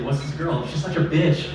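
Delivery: normal talking voice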